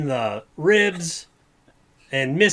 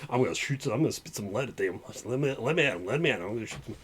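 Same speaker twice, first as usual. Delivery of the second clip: Western Pogli; 5 semitones lower, 7 dB quieter, much faster than usual. Acted. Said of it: "Pogli with a Western accent".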